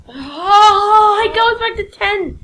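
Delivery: loud, high cry of dismay; annoyed. Real, not acted. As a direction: yelp at the setback. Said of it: "I was just annoyed".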